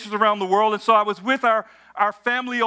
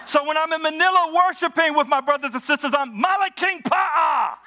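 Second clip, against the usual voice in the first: higher, laughing, stretching out Malaking Pa'a; laughing punchline; 7 semitones higher than usual. Real, not acted.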